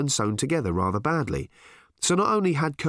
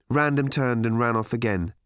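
usual speaking voice

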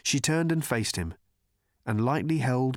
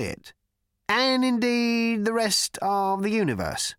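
awkward earnest cricketer; 8 semitones higher than usual. A narrator's reading. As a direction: struggle to make the argument sound sensible. Cricketer fumbling his argument.

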